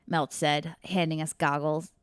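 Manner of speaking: normal talking voice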